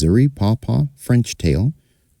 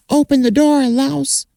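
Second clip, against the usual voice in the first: high-pitched shrill call through the door; a small creature yelling much higher; shouting flea. Acted. Flea shouting to Louse at the door, high shout. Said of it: "she shouted to Louse".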